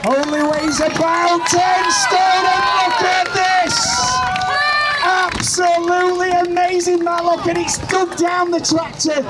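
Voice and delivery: hyped-up commentator; excited shouting. Real, not acted. Commentator cheers on Emma's pull.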